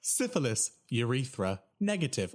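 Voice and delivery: robotic Siri-like readout, each word separate; automated phone voice. Retold or imitated. Results hotline listing STD results, stilted automated voice. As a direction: recite each item with the same falling tune. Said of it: "Like, it's like that".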